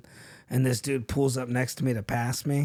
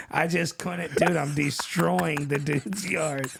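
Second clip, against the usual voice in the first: ordinary talk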